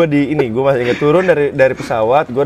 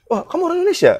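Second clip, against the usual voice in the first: much higher, mock surprised greeting; imitating surprised Elianus; faster than usual. Elianus spotting a fellow Indonesian, high mock surprise. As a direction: pitch it up like a delighted stranger. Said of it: "Dia ngeliat".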